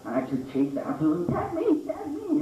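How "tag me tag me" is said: put-on high, pleading kid voice; desperate kid. Lonely kid chasing the others, high pleading voice. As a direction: beg to be tagged. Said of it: "It wasn't desperate at all".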